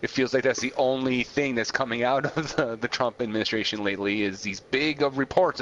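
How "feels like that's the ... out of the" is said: ordinary talk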